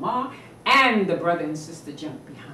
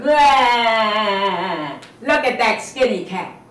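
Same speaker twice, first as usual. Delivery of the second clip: mocking kids; 2 semitones higher, 10 dB louder, much slower than usual. Children mock the cat.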